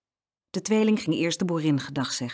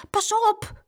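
high, urgent warning; warning cry; 3 dB louder than usual. A narrator's reading. Boy warning the hen near the ditch, urgent cry. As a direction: call out a high-pitched alarm. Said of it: "riep Jeroen".

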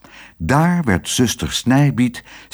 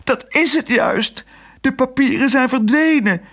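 helpless nurse, pitched way up; desperate wail. A narrator's reading. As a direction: whine it out in despair. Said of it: "jammerde de zuster wanhopig".

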